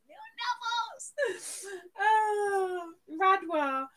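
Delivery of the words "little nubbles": squeaky-high little voice; high-pitched coo. Pretend. Streamer cooing over Nubbles, high and playful. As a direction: jump right up high, playfully. Cooing the toy's name two ways.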